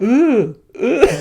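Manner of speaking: hammed-up high moaning ooh; mock moaning. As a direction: coo it high like the silly face. Mimicking the crossed-eyes trend moan.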